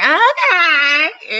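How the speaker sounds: high, drawn-out loud okay; a wife half-offended, playing it up; mock jealous okay. Wife reacting to husband complimenting Monique, high drawn okay. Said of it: "I was like".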